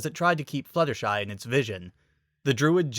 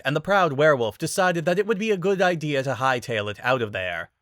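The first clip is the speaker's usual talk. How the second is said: ordinary delivery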